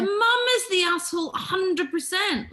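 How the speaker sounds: emphatic shouted verdict; shouting. Real, not acted.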